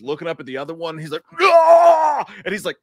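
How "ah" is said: high, loud pained scream; hammed-up agony scream; mock scream. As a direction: cry out like being electrocuted. Retold electrocution scream.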